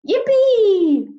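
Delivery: yippee, high and loud; gleeful cheer. Acted. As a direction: squeal it with pure joy. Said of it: "I said".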